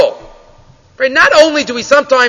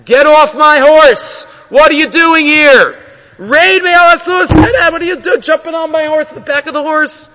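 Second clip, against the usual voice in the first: poor man yelling at the rich man to get off his horse, furious; angry shout; 5 semitones higher, 4 dB louder than usual. Acted. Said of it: "turns around to the rich man and says".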